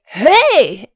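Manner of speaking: loud high hey; excited shout. Pretend. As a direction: jump in with a shout. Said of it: "run around and be like".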